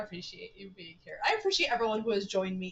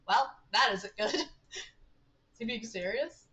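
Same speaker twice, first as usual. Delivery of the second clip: ordinary talk, nothing marked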